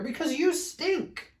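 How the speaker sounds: very high teasing squeal; squealed insult. Acted. Fluffy Bear tells Smelly Bear he stinks, very high. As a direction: squeal the punchline. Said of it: "shouted Big Fluffy Bear".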